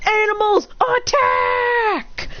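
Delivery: high, drawn-out shouted command; battle command. Acted. Hero sending animals at soldiers, commanding shout. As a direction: shout the charge, stretching the last word.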